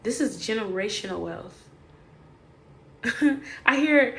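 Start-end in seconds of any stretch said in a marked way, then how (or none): 3.0-3.6 short laugh. Real, not acted. Laughs before voicing ancestors.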